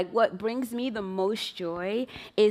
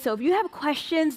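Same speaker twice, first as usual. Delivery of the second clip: normal talking voice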